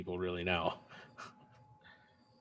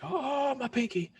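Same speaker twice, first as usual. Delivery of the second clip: mock pained whine; slower than usual. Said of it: "and I was like".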